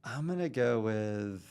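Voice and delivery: slow, stretched-out words; drawn-out deliberation. Real, not acted. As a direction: draw out the verdict, pausing for suspense. Hesitantly deciding on a coffee rating.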